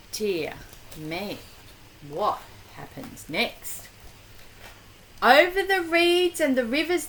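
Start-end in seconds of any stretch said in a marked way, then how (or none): 0.1-3.9 much softer and lower, hushed; hushed wonder. Pretend. Reader asking child what's next, hushed and low. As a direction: lean in with a secret hush.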